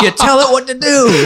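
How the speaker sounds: high, acted telling-off; mad boss. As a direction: imitate an irritated boss giving orders. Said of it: "my boss gets mad at me".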